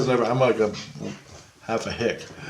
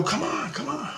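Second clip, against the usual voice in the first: coaxing high and soft; 9 dB quieter than usual. Retold or imitated. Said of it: "And I go".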